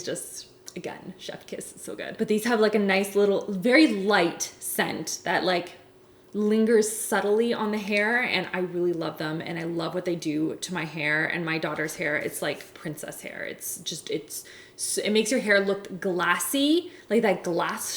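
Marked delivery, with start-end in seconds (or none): 0.0-2.2 whisper. Real, not acted. Whispered praise of hair mask. 12.2-15.0 whisper. Real, not acted. Whispered aside about daughter's hair.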